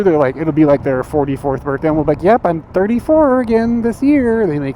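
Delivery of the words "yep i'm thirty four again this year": high, slow mock coy woman; women lying about age. Women joking on birthdays, mock coy high voice. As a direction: play a coy woman fibbing her age. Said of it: "what do women always do".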